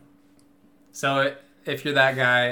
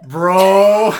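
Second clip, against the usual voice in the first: exasperated bro; 5 semitones higher, 11 dB louder than usual. Retold or imitated. Stunned reaction to betrayal.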